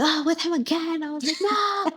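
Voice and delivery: high-pitched whine of protest; mock dismay. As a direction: groan it high, hammed-up despair. Acts dismay at being regrouped.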